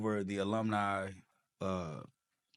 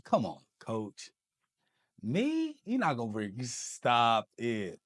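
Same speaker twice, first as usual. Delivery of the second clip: disbelieving laugh-off; 4 dB louder, slower than usual. Retold or imitated. Thinks the coach is joking.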